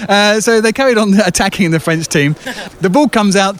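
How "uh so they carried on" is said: ordinary delivery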